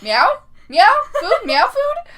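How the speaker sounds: loud, high, hammed-up meowing; mock-exasperated grandma, shrieking up high; frustrated grandma. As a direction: meow insistently to be understood, laughing through it. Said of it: "getting frustrated".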